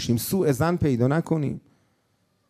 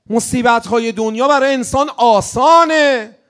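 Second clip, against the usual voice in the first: loud, slow sermon delivery; impassioned sermon; preaching at full force; 9 dB louder than usual. Acted. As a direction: preach it out to the crowd. Loud preaching over music.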